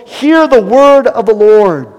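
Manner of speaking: loud, high booming command; proclaiming. Acted. Preacher repeating God's word to the bones, booming.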